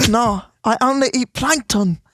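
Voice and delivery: put-on high, bright-pitched New Zealand accent. Offering a chip to Brie, playful New Zealand accent. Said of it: "a New Zealand accent instead".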